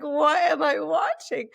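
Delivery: mock disbelief at a strange video; baffled disbelief. Retelling the silly singer clip, asks in slow baffled disbelief.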